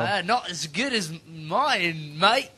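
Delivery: put-on drawn-out Australian accent, rising on mate; mock Australian accent. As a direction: put on a slow, exaggerated Aussie accent. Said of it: "the best Australian accent we've had".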